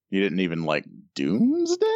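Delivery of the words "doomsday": "Doomsday?" with a steep, very high rising question tune; question intonation. Shown as a demonstration. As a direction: ask "Doomsday?" and sweep the pitch way up at the end. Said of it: "You didn't even say it like a question".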